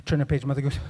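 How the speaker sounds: normal talking voice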